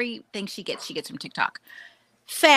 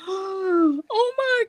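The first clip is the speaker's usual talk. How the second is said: high, gushing imitation of delight; gasp, then squeal it in mock wonder; awestruck gasp; 12 semitones higher than usual. Daughter walking into Whole Foods, awestruck.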